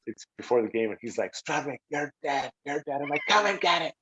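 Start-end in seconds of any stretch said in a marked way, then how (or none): 1.3-3.0 slow, softer menacing impression; drawn-out mock threat; threatening rival. 3.3-3.9 high-pitched put-on bravado; mock-defiant challenge in a raised voice; acting tough. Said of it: "trying to act tough".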